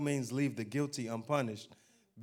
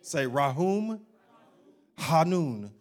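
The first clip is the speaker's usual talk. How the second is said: louder call to repeat Rahum Hanun; leading the congregation; 6 dB louder, slower than usual. Shown as a demonstration. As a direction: get them to say Rahum Hanun back. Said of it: "Y'all wanna say some Hebrew".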